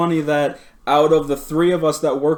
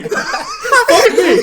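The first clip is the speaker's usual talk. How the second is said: much higher, louder, laughing through it; laughing mock boss; 6 dB louder than usual. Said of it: "He's like".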